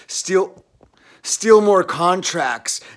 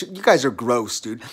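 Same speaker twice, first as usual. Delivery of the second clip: ordinary talk, nothing marked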